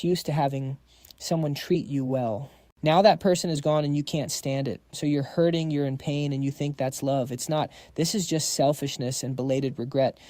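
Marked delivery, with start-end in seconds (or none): none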